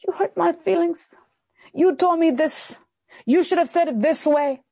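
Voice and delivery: high, mocking hurt voice; whiny complaint. Preacher mocking people who resent correction, high whine. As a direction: whine it like a sulking churchgoer. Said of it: "people have the nerve to look at the servants of God and say".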